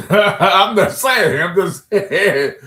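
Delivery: laughing through the words, slow and loud. Real, not acted. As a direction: crack up while insisting it's a joke. Laughing at his own joke.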